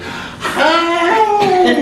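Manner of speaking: put-on very high, bright child's greeting; squeaky little girl's hi. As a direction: chirp it like a tiny girl in a cart. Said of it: "she looks at me and goes".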